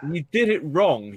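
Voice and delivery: ordinary talk, nothing marked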